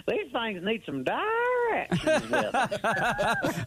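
mocking how the cashier heard his Southern accent; hammed-up Southern accent. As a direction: stretch every word like a cartoon Southern yokel. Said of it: "Like you jumped out of Hee Haw".

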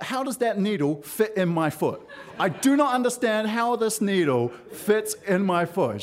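high-pitched, lightly acted incredulity; mock disbelief. Balking at doctor's long needle.

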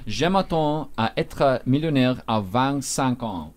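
"vingt-cinq" said as French vent-sank; French demo, slowly. Shown as a demonstration. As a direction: read the French slowly and clearly. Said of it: "the C I N Q word".

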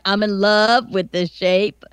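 slow, low mock Southern drawl; teasing Southern accent imitation; imitating Tyler's accent. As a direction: draw it out in an exaggerated Southern accent. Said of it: "And Tyler's like".

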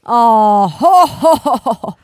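big belly-laugh ho-hos; loud Father Christmas chortle; Santa. Acted. Santa reacts to the broken belt, booming ho-hos.

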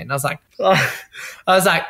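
ordinary delivery

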